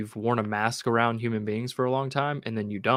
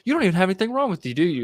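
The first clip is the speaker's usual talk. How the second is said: boss accusing; 8 semitones higher, 5 dB louder, much faster than usual. Retold or imitated. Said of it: "he's like".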